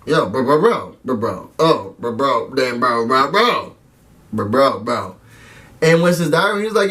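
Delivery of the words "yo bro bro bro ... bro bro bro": low, repeated bro chant; bro talk. Retold or imitated. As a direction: lightly mimic two bros hyping. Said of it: "Winston goes to Brett".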